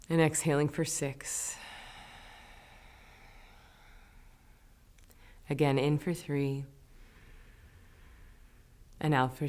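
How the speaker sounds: soft, unhurried meditation guidance with long pauses; guided breathing. Real, not acted. Meditation teacher guiding students through doubled exhales, soft guided breathing. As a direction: calmly count them through each breath.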